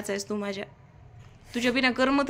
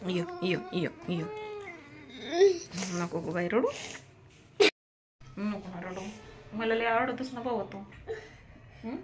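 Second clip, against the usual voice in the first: hushed, tender soothing, much softer; soft comforting; 10 dB quieter than usual. Real, not acted. Mother hushing her crying little son, softly tender. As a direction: soothe him gently, almost murmuring.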